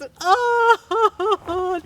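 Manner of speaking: loud high mock sob; a mock wail; hammed-up sob. Wife sobbing between lines, loud high mock wail.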